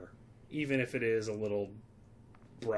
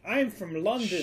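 higher, louder mock London accent; hammed-up fake British accent; 8 semitones higher, 5 dB louder than usual. Teasing the co-host who hates fake accents, hammed-up fake British accent. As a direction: ham up a bad British accent to tease the host. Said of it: "I don't wanna do fake British accent".

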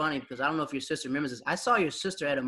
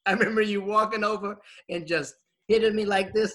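ordinary talk

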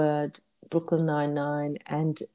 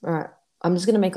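normal talking voice

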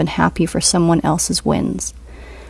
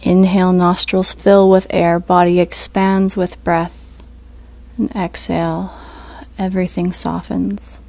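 guided meditation; 4 dB quieter than usual. Acted. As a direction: ease them into stillness, unhurried.

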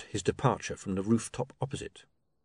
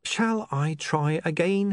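higher, slow, long-suffering question; sighing; 7 semitones higher, slower than usual. A narrator's reading. Mister Pin starts explaining to his partner, slow and long-suffering. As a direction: offer wearily, slowly, to explain. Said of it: "Mister Pin sighed".

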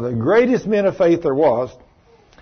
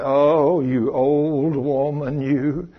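mock lament; much slower than usual. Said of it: "people say".

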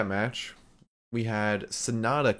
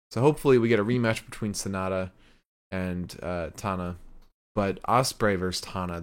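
usual speaking voice

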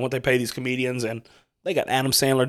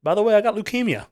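higher, offhand mock announcement; mock casual; 7 semitones higher than usual. Podcaster mocks the casual leukemia reveal, higher. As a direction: toss it off like nothing.

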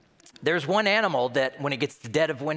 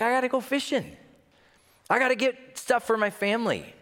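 busy fisherman; 8 semitones higher than usual. Retold or imitated. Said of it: "they're like".

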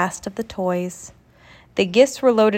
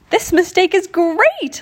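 high, bright excited cry; a child thrilled at a happy mistake; excited exclaim; 9 semitones higher, 4 dB louder than usual. Acted. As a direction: burst out with delight. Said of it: "Leah exclaimed".

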